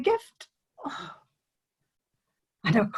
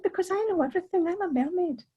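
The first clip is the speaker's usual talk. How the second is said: higher, quick know-it-all mermaid; lightly voiced mermaid, a bit higher and quick; smug mermaid; 8 semitones higher than usual. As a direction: tell him breezily she knows it all. Mermaid boasts to Tom.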